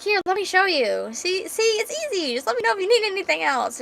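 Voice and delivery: very high, singsong acted helpfulness; eager helper. Man showing her how to use it, eagerly helpful. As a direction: chirp it high, quick at the end.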